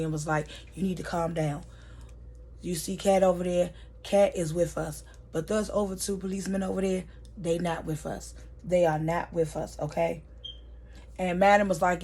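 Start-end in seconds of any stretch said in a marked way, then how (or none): 0.7-10.2 softly spoken, measured reassurance; quiet and calm. Retold or imitated. Blue backing Madam up to settle her, quietly calm. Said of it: "very quietly and calmly".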